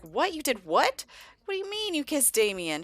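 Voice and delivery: mock-shocked, incredulous questioning; shocked brother. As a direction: react to scandalous news, high and indignant. Brother shocked at sister's confession.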